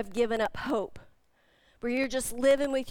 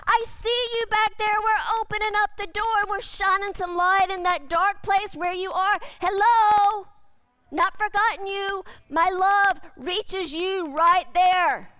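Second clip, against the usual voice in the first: a hint of God calling, higher and a bit louder; God's voice; 5 semitones higher, 3 dB louder than usual. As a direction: reach into the closet with your voice. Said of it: "it's saying".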